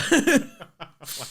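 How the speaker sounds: bright burst of laughter; laughing. Real, not acted. Host laughing at own joke, real laughter.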